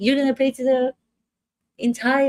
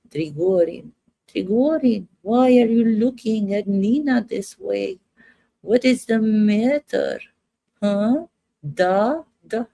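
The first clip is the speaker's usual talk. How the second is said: put-on Russian accent; 2 semitones higher than usual. Actress confronting lover for looking at Nina, Russian accent. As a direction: call out and question in a Russian accent. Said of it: "When I do an accent".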